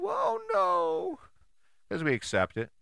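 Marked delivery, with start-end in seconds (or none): 0.0-1.2 high falsetto oh no; cartoonish falsetto gasp of dismay; mock dismay. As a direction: squeak it out in mock alarm.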